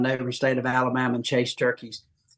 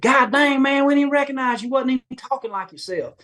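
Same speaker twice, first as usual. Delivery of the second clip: teasing buddies; 9 semitones higher than usual. Retold or imitated. Hometown buddies mocking him for sounding citified, high teasing voice. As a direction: rib him like an old friend from back home.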